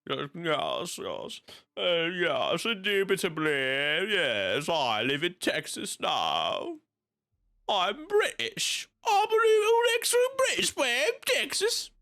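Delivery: exaggerated British accent, climbing very high; hammed-up British voice, stretched and silly, with Texas creeping in; mock British accent. Streamer teasing a British viewer living in Texas, mock British accent. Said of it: "you have a British accent".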